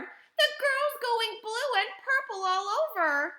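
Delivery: high, loud hysterical yelling; frantic mother, very high and loud; yelled in panic. A narrator's reading. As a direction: yell in mounting panic at a daughter. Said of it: "yelled Missus Beauregard".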